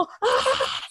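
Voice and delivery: high startled scream; retold high shriek of fright. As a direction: shriek as the roach flies at you. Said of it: "y yo como".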